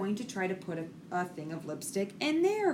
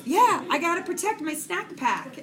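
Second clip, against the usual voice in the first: put-on high, louder kid's reply; quoted young son; 9 semitones higher, 6 dB louder than usual. Son explaining himself to mom, high insistent quoted voice. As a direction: play an insistent little boy, pitched up and louder. Retelling her young son's answer.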